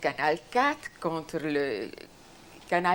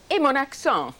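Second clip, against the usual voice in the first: high, loud acted objection; retold protest; 8 semitones higher, 8 dB louder than usual. Protesting to the director about her accent, raised-voice objection. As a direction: throw it out as an emphatic protest.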